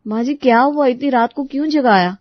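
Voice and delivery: daughter-in-law to mother-in-law, puzzled and polite. Acted. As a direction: ask gently why she was woken. Preeti answers mother-in-law.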